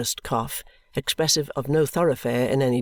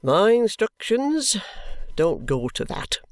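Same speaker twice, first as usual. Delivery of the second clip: firm official voice, a touch slower; stern constable; 7 semitones higher, slower than usual. A narrator's reading. Constable lecturing the ragged boy, firm and official. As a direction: lay down the law like a Victorian policeman. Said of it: "replies the constable".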